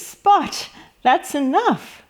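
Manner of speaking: Mum's sharp call, pitched high on Spot; a playful telling-off for the kids; lightly voiced scolding mum; mock scolding Mum. Mum stops Spot's mess.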